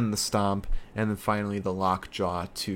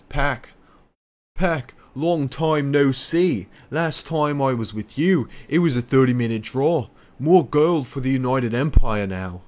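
Ospreay promo voice; 4 semitones higher, 7 dB louder than usual. Retold or imitated. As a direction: ham up a cocky wrestler calling out his rival. Reading Ospreay's promo aloud.

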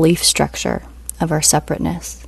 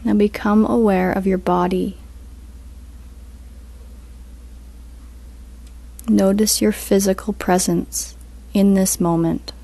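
guided meditation; 4 dB quieter than usual. Acted. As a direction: ease them into stillness, unhurried.

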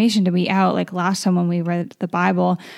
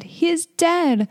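"He is dead" said slow and much higher, in shock; gasping; 10 semitones higher, slower than usual. Acted. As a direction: let the shock lift your voice up high and slow. Crowd declares boy dead.

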